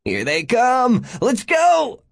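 high, loud whooping; soaring, exhilarated yell; gleeful whoop. A narrator's reading. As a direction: whoop it out, thrilled by the chase. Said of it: "began whooping with glee".

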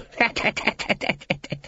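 high breathy staccato cackle; long rattling wheeze of a titter; villainous snicker. Acted. Guild boss enjoying his victory, villainous snicker.

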